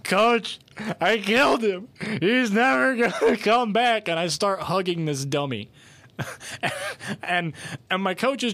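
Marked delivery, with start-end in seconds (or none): 0.0-4.1 high, loud, laughing mock wail; mock crying. Student tells the coach the dummy is dead, mock-sobbing wail. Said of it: "I'm starting to cry".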